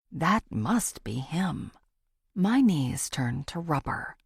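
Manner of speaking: ordinary delivery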